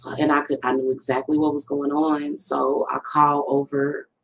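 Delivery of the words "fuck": breathy whisper, almost no voice; whispered curse. Retold or imitated. Mother at home with kids whispering in shock. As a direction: hiss the curse under your breath. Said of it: "and I'm like".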